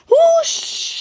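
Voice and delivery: very high, loud rushing whoosh; steam whoosh. A narrator's reading. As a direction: let the steam burst out. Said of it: "he hissed".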